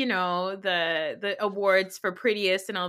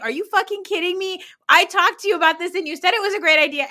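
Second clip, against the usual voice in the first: higher, louder, fast indignant rant; furious imitation, rushed and raised; mock outrage; 7 semitones higher, 6 dB louder, faster than usual. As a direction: blow up at a backstabbing friend. Acted outraged reaction.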